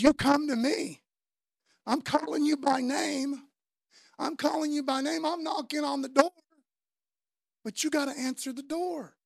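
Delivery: a fervent voice of God calling them by name. Acted. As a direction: call out to the congregation as God knocking. Preacher voicing God's call.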